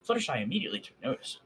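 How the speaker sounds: ordinary delivery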